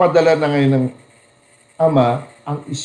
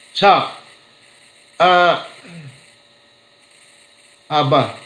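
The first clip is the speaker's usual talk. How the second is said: ordinary talk, nothing marked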